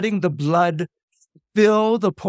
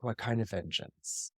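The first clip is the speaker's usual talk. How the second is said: hushed question; 7 semitones lower, 14 dB quieter than usual. Acted. Electra asks brother about his plan.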